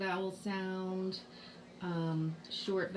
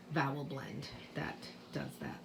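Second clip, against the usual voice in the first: whispering; 5 semitones lower, 7 dB quieter than usual. Real, not acted. Trailing aside.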